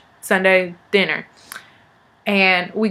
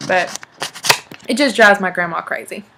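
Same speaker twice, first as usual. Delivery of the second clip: ordinary delivery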